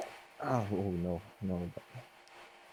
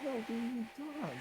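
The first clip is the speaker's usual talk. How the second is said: put-on girly voice; 3 semitones higher, 9 dB quieter, much faster than usual. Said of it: "Stop talking like that".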